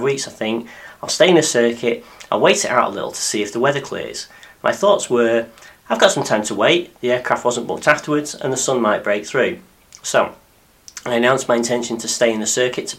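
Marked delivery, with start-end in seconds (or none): none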